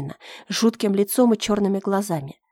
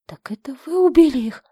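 higher, louder shocked question; horrified question; 6 semitones higher, 4 dB louder than usual. Acted. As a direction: recoil at the confession. Girl horrified at killer.

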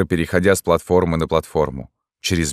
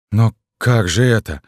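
higher, dismayed question; bewildered; 5 semitones higher, 4 dB louder than usual. A narrator's reading. Rejected lover cannot understand her, bewildered. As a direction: ask it at a loss. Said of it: "сказал Дентон растерянно".

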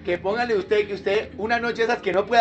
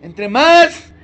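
louder, higher, slowed preaching cry; fervent preaching; 5 semitones higher, 8 dB louder, slower than usual. Acted. Preacher crying out Jesus' prayer to the church, fervent. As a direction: proclaim it with full force.

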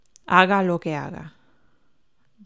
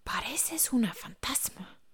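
softer whispered tease; 4 semitones higher, 9 dB quieter than usual. Acted. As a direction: breathe it out like a ghost story. Startled, hushed in the night.

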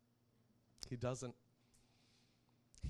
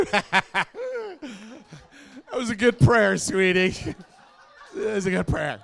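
loud mock sobbing through laughter; crying and laughing; 6 semitones higher, 14 dB louder than usual. Dad praising daughter's silly prayer, laughing and mock-crying. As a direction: wail the praise while cracking up. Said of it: "I am crying, and I'm laughing".

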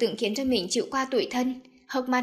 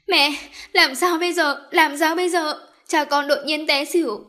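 higher, louder, tearful panic; crying panic; 5 semitones higher, 6 dB louder than usual. Acted. Daisy crying to her mother on the phone. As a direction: sob it out in a panic. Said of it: "tiếng khóc của cô".

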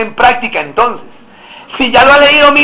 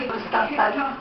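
soft, coquettish greeting with a lilting rise; flirtatious; 15 dB quieter than usual. Shown as a demonstration. Preacher demonstrating a greeting to the congregation, coquettish. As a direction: coo it flirtatiously. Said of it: "Qué coqueto es".

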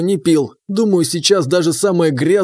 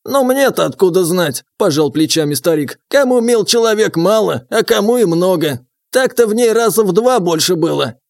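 put-on higher, wry old man; old prospector; 3 semitones higher than usual. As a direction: shrug it off like an old-timer. Old man voiced, shrugging.

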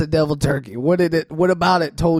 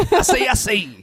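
Foghorn Leghorn voice; 6 semitones higher than usual. Pretend. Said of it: "a mix between him and Foghorn Leghorn".

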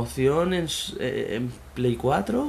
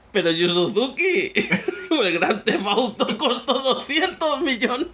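much higher, slower, cracking up mid-line; laughing disbelief; 10 semitones higher, slower than usual. Pretend. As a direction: squeal it out through the laughter. Disbelieving reply in an imagined scene. (incredulidad entre risas, mucho más aguda y lenta)

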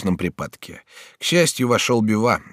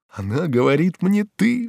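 marvel, jumping up at the end; amazed exclamation; 12 semitones higher than usual. A narrator's reading. Bonifas hears Batilda address him familiarly, amazed. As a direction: pitch leaping high on the last word. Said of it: "вскрикнул Бонифас".

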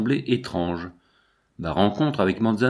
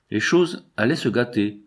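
plain reading voice

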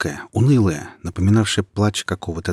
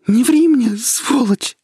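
furious shout; 8 dB louder, slower than usual. A narrator's reading. Girl answers the frightened boy.

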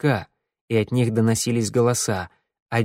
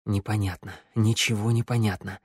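plain reading voice

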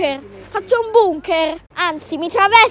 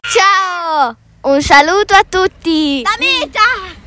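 put-on Bolognese accent; slower than usual. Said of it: "L'hai detto saluto alla bolognese".

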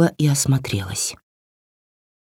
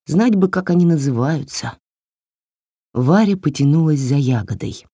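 ordinary delivery, nothing marked

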